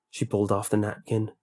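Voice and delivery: usual speaking voice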